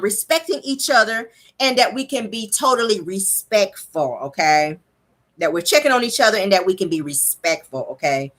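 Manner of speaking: slow, breathy-sounding imitation of Melody, drawn out; Melody's voice. Host imitating Melody asking the group for respect, slow and drawn out. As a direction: imitate Melody pleading gently for respect, slowly. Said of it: "let me say it in her voice".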